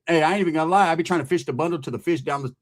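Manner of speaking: usual speaking voice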